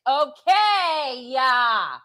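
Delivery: put-on high, drawn-out whiny kid voice; exaggerated high-pitched huff; mock bratty child. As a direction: imitate an exasperated daughter, stretching each word. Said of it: "she's like".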